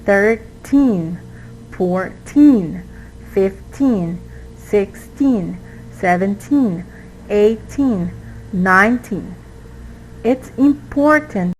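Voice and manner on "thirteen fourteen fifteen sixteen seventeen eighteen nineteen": put-on thirteen to nineteen, each with a long, falling stressed -teen. Shown as a demonstration. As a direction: lean on TEEN in thirteen, fourteen, fifteen and the rest. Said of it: "The teen is the stress".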